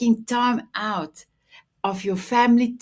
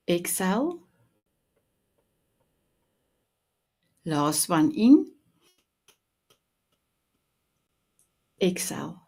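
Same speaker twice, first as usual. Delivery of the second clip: guided breathing; 3 semitones lower than usual. Acted. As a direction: calmly guide each breath in and out. Leads three deep breaths.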